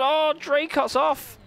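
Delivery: sudden shout of alarm, high and slow; excited. Real, not acted. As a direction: leap up in pitch as the car spins. Driver spins off in race.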